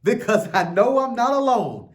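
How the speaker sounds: a preacher's impassioned echo. Acted. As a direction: say it again with conviction, as if God moved you. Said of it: "I felt God on that".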